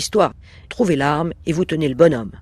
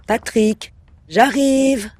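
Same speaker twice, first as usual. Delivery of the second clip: bright, higher call down the stairs, a bit louder; cheery call; 6 semitones higher, 3 dB louder, much slower than usual. Acted. Wife calling down to her husband, falsely cheerful. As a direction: sing out his name like all is well.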